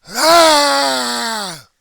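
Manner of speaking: very high, very loud put-on screams; hammed-up furious screaming; mock heckler screams. Heckler screaming in his face, hammed-up screams.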